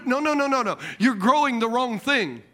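normal talking voice

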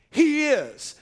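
"he is" slow, each word weighted and firm; emphatic he is. Shown as a demonstration. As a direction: lean hard on "he is", slowly. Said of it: "said in the emphatic".

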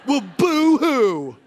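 slow, loud mock sympathy; teasing fake pity; mock boo-hoo. Real, not acted. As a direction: drag out a sarcastic boo-hoo. Mocking the complainer.

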